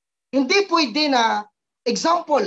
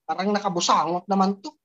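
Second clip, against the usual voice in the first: fast, quiet, lower grumble under the breath; downline grumbling; 6 semitones lower, 5 dB quieter, faster than usual. Pretend. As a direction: mutter the complaint quickly and low. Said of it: "Yung hindi makikita sa'yo na". (mabilis at mahinang reklamo)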